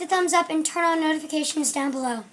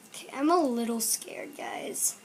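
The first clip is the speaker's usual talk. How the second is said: whispering; 4 semitones lower, 7 dB quieter than usual. Real, not acted. Nervous before tasting Warheads.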